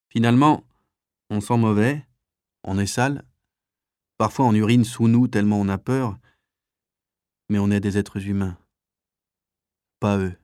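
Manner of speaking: halting, drowsy phrases with pauses; mildly sleep-thickened. Acted. Bunk neighbour before falling asleep, lightly drowsy voice. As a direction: speak slightly heavy with sleep, pausing between thoughts. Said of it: "la voix empâtée de sommeil".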